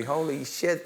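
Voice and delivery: ordinary delivery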